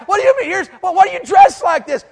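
high, loud, disbelieving squeal; hammed-up outraged question; mock incredulous preacher. Acted quarrel with Shay.

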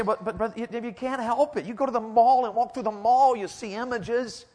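mock a whining excuse; whiny objector. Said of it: "You say".

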